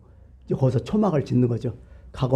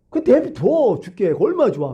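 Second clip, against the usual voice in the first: spiteful dismissal; 6 semitones higher than usual. Acted. Preacher voicing Jonah wishing Nineveh left to die, spiteful. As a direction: drag it out as if glad to be rid of them.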